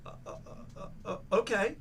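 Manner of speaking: faint, breath-only stutters rising to okay; flustered stammer. Retold or imitated. Guest replying to a sudden Saturday invitation, re-enacted flustered stammer. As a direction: act caught off guard, groping for an answer.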